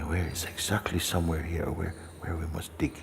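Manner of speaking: soft, quick muttering to oneself; preoccupied treasure hunter thinking aloud; searching murmur. Acted. Acting out a treasure seeker searching.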